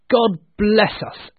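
high, loud, slow proclamation; drawn-out, joyful closing cheer; ringing blessing. A narrator's reading. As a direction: proclaim it as the grand last line. Closing blessing quoted from Tiny Tim.

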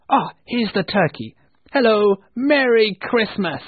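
elated, ringing holiday cheer; joyful greeting. A narrator's reading. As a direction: call out a jubilant welcome, stretching it. Reformed Scrooge greets the turkey's arrival.

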